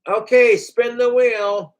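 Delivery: high, slow, announcer-style call to spin; mock host voice calling out the wheel spin; game show host. To the contestant, a hammed-up game show host voice.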